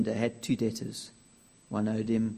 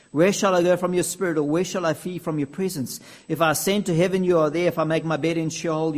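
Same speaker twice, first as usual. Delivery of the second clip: louder and higher, soaring; fervent psalm reading; 4 semitones higher, 5 dB louder than usual. Acted. Preacher reciting Psalm 139 to the congregation, fervent and loud. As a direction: proclaim the psalm with rising fervour.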